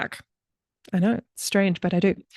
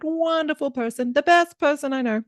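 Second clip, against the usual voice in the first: much higher, hammed-up braggy politician voice; Donald Trump impression; 8 semitones higher than usual. Podcast host introducing her guest, mock Trump boast. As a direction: puff it up like a boastful president, tongue in cheek. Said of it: "That's my best Trump impression".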